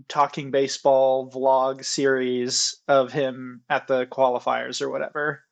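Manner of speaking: usual speaking voice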